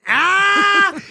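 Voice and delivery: long high-pitched yell; sudden loud "ah" held high; loud high cry. Real, not acted. Teased partner, sudden cry.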